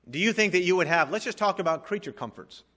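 fervent sermon climax; preaching at full force. Acted. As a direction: drive each question home to the congregation. Preaching rhetorical questions.

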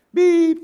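short, high, loud beep; a sharp loud machine beep; mimicked monitor beep. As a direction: blurt out a heart monitor blip. Heart monitor beeping mid-rant.

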